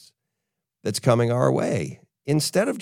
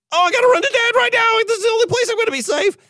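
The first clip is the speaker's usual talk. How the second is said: put-on high, fast childish panic voice; a child's frantic inner voice, much higher; mock panicky child; 4 dB louder, much faster than usual. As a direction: play the kid bolting to dad in a rush.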